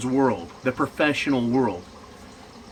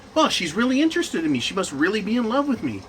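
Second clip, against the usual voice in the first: imitated naive friend; 6 semitones higher, faster than usual. Acts out the deluded friend's reply.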